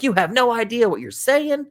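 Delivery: high, mock scolding teacher voice; scolding principal. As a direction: lecture the kid sternly.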